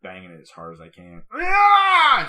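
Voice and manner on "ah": very high, loud pretend scream; mock scream. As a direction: scream it out in comic rage.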